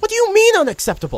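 high, loud disbelieving protest; incredulous outburst. Acted.

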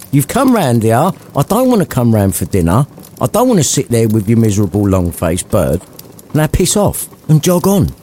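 dismissive. Acted. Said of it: "Scrooge added dismissively".

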